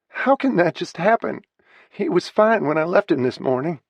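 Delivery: high, loud, stunned cry of disbelief; the news has just hit and makes no sense; shocked disbelief. Acted. Daughter pleading with the paramedic, loud and stunned. Just told her father died.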